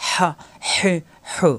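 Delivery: "হা, হে, হু" squeezed from mid-throat; hissing throat h. Shown as a demonstration. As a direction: press the throat and let air rasp on each h. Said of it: "কণ্ঠনালীর মাঝখানে চাপ দিয়ে ফ্যাঁস ফ্যাঁস আওয়াজ".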